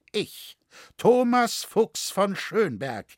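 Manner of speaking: high, loud, slow proclamation; stately, ringing reveal; grand self-announcement. A narrator's reading. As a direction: announce your name with full authority. Stranger solemnly reveals his identity.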